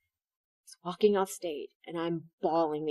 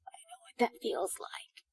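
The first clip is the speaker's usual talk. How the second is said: soft whispered recognition; whispered empathy; 3 semitones higher, 11 dB quieter than usual. Retold or imitated. An audience member murmuring recognition in a whisper. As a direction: breathe it as a moved audience member.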